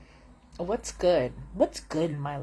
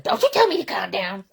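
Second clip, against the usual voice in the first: louder, indignant quote of the caller; mock angry caller; 9 dB louder, faster than usual. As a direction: play the furious customer. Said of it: "she's like".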